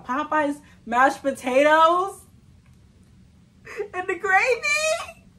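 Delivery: slow, high, loud gushing; excited gush. Real, not acted. Vlogger raving to viewers about Popeyes gravy, gushing high. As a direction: savor each word with glee.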